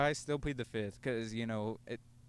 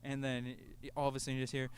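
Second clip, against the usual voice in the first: usual speaking voice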